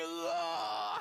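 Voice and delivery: huge high roaring "aah"; explosive, full-force battle cry; even bigger yell. Acted. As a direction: bellow it out with all you have. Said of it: "더 크게".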